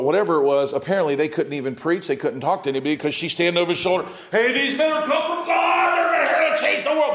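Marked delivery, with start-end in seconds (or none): 4.3-7.1 put-on shrieking possessed girl. Fortune teller girl shouting after Paul in the street, shrill high shout.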